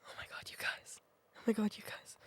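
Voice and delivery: hushed, unvoiced whisper; barely-there whisper; whispered exclamation. Real, not acted. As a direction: whisper it to the viewers in disbelief. Whispered aside to viewers in disbelief.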